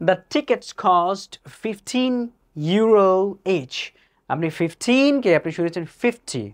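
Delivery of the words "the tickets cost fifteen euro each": very slow, every word drawn out; slow dictation read. Shown as a demonstration. Teacher reading a listening-test sentence: very slow dictation. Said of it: "next কইলো কি".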